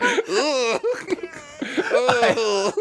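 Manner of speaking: high, laughing mock groans. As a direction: groan in exaggerated despair.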